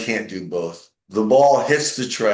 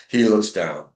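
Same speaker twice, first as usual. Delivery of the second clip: usual speaking voice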